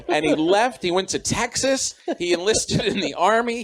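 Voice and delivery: normal talking voice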